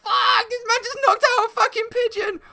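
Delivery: very high, loud, incredulous outburst; mock shock. Diner watching a pigeon get punched, high-pitched mock shock. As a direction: replay your shock at full, high pitch. Shocked onlooker with rice box.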